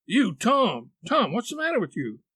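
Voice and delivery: frightened aunt demanding an answer; gasped alarm. A narrator's reading. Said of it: "she gasped out".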